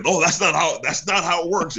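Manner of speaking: high-pitched mock protest; mock objectors. Host voicing people who will object to his story, mock indignant. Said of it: "people are gonna be like".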